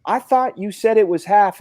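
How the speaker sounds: high, loud exasperated shout; exasperated yell. Retold or imitated. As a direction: yell at the friend in disbelief. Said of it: "I said".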